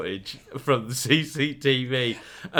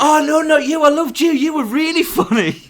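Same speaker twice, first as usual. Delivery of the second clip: very high, loud mock panic; mortified backtracking; 9 dB louder than usual. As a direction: squeal an apologetic retraction, hammed up. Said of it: "she was so embarrassed".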